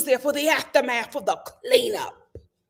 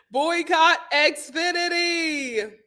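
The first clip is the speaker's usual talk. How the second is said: drawn-out chant; mock rallying cry; slow emphatic; 4 semitones higher, much slower than usual. Shown as a demonstration. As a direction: slowly spell out the boycott. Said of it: "repeats slow emphatic".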